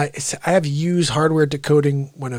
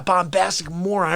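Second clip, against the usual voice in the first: normal talking voice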